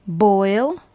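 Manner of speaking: ordinary talk, nothing marked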